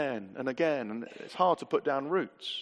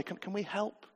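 softly voiced, higher-pitched tentative offer; quiet offer; 6 semitones higher, 7 dB quieter than usual. Church member noticing someone in need, quiet offer. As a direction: ask it gently, keeping the volume down. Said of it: "they just quietly say".

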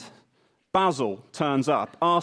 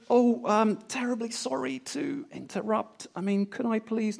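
higher, softer apologetic voice; timid polite Brit; 7 semitones higher, 5 dB quieter than usual. Pretend. What Cyril is not, a timid apologetic knock, higher and softer. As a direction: apologise meekly, mock-timid. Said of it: "with Cyril, there's no".

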